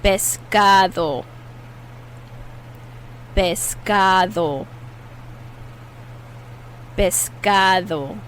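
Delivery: Pescado slowly, the D softened to a TH sound; soft D. Shown as a demonstration. Teacher modelling Pescado slowly for learners, soft D. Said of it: "a soft D that sounds like a T H".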